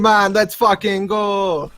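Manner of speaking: hammed-up Canadian accent, stretched slow; rowdy Canadian urging everyone on. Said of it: "my Canadian".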